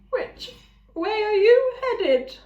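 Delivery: friendly eager ram; Thud the ram. A narrator's reading. Ram introducing himself to the robot, eager. Ram's voice.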